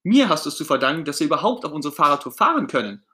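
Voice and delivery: high, loud outraged protest; indignant Ernie. Acted. Ernie protesting that Bert owes him the tour, indignant. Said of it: "Ernie ist empört".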